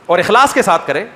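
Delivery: ordinary talk, nothing marked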